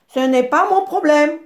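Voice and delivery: réplique sèche, plus haute et plus forte; envoie-le promener avec mépris; grognement du babouin. A narrator's reading. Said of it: "grogna le babouin". (grogne au nez de l'antilope)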